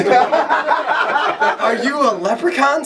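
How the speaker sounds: high, drawn-out mock amazement; astonished question. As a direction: ask it slowly in high-pitched disbelief. Acted astonished question to leprechaun.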